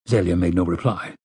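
ordinary delivery, nothing marked

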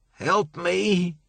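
choking, pain-racked plea, pitched high; broken whisper. A narrator's reading. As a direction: gasp it out like a dying man. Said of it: "It was a broken whisper".

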